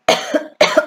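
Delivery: short throat-clearing cough; dry cough. Real, not acted. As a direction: cough from choking on a drink. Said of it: "Je suis désolée".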